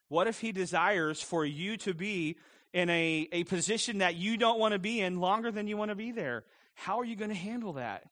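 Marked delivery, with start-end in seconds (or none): none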